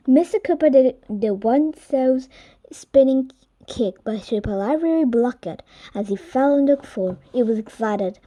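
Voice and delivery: ordinary delivery, nothing marked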